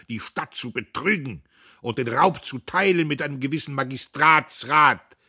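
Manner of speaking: loud, high, slowed at first; loud, high righteous harangue; bellowing speech. A narrator's reading. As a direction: roar down the hall with accusations. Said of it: "und er brüllte".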